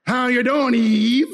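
lightly voiced sly tempter, drawn out; a hint of a slick, smooth-talking serpent. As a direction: glide into a rising, sly greeting. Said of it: "Clever and slick".

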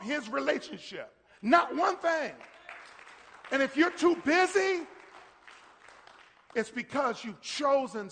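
loud, high, shouted preaching; full-force preaching. Real, not acted. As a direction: preach it hard and loud at the congregation.

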